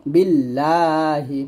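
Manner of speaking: বিল্লাহি slowly with doubled ল্ল; slow recited word. Shown as a demonstration. Teacher sounding out বিল্লাহি syllable by syllable. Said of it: "হাজের হি".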